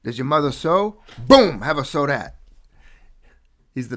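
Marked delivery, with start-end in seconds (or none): 0.0-2.4 higher, loud Boom in a mobster quote; light Christopher Walken imitation. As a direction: deliver the janitor's punchline with a bang. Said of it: "He said".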